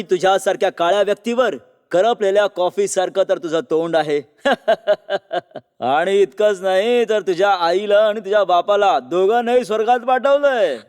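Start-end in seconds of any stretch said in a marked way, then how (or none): 4.4-5.6 gloating, staccato laugh; mocking villain laugh. Villain laughs at his own insult. 5.8-10.8 lightly menacing villain; gloating reveal. Acted. Villain reveals killing her parents.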